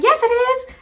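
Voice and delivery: loud high-pitched denial at the sink; insistent shout. Retold or imitated. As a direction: shout her down with giddy certainty. Insisting the splashing works.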